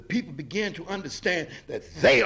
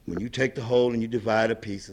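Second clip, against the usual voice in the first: ordinary talk, nothing marked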